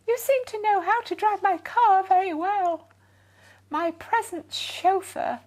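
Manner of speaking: high, loud and gushing with thanks; a posh lady gushing her goodbyes; grateful fussy lady. A narrator's reading. Lady offers Dad a job.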